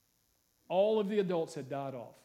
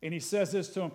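ordinary talk

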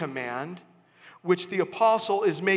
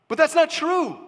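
emphatic rebuttal; 9 semitones higher, 4 dB louder than usual. Real, not acted. Preacher rebutting a mistake.

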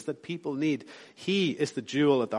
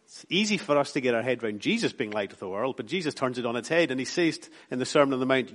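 normal talking voice

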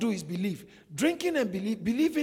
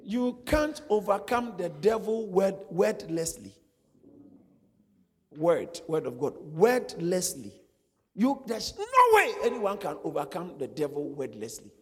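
forceful preacher's proclamation; preaching; 5 dB louder than usual. Acted. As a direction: preach it out, hammering each word.

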